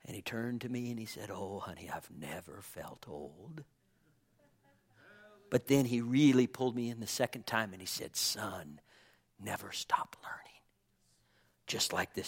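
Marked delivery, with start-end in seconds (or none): 1.3-3.6 hushed confiding. Retold or imitated. Papa answering near the end of his life, hushed. Imitating Papa's intimate last words. 8.2-10.6 low, near-whispered advice; hushed confiding. Retold or imitated. Papa pulling his son in close, hushed confiding.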